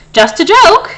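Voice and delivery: high, loud chuckling; chortled. A narrator's reading. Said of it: "he chortled".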